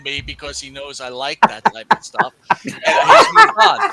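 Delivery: laughing while mocking the friend; giggling mock lament; laughing through it.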